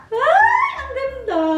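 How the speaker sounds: high, loud gasp of delight at the bag; delighted squeal. Real, not acted. Vlogger gasping at her new bag, delighted squeal. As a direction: squeal over how pretty it is.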